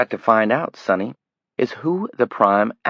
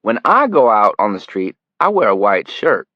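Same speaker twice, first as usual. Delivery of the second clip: a touch louder, hard contempt; menacing lecture; 3 semitones higher, 8 dB louder than usual. Acted. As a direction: lay it out with contempt.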